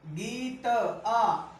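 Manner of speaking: गीत आ drawn out long on आ; slow stretched. Shown as a demonstration. Teacher stressing the joining vowel to students, very slow. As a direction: hold the आ in गीत आ. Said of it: "teacher stretches the vowel sound".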